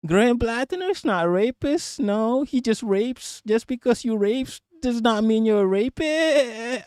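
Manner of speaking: high-pitched mock American drawn upward; attempted vocal fry. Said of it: "I can't even do it".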